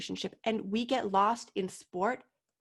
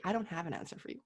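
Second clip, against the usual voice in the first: soft admission; 3 semitones lower, 7 dB quieter, much faster than usual. Retold or imitated. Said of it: "that's hard as a coach sometimes to say".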